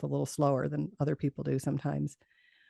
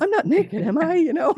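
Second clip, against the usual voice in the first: high and louder, laughing through a mock-panicked question; 13 semitones higher, 7 dB louder than usual. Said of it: "I'm just totally freaking out".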